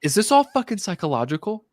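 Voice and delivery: exasperated boss. Retold or imitated. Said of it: "And he was like".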